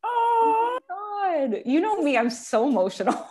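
high, tearful, voice breaking; real tears rising; about to cry. Real, not acted. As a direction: choke up on the words. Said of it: "I'm gonna cry right now".

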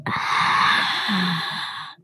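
a soft, breathy little ah under the breath; quiet ah. Retold or imitated. Child on the plane, quietly sighing ah. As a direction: let out a hushed ah, barely voiced. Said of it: "I quietly said".

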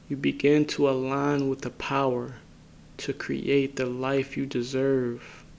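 unhurried phrases, each left to settle in silence; guided meditation. Acted. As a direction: guide listeners inward calmly, leaving space after each phrase.

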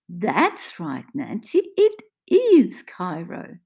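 put-on slow, loud stress on the answer as if to a child; condescending carer; patronizing praise. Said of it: "recognizing the patronizing tone".

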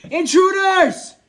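full-out, high drawn-out yell; long, loud, high-pitched cry of warning; yelled alarm. Acted. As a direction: raise the alarm to the whole camp. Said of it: "continues to yell".

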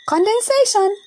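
high, loud announcing call. Acted. Ava telling Babsy what is happening, calling out high and loud. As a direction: call it out proudly. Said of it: "Ava calls out".

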